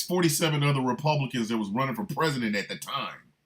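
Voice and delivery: ordinary talk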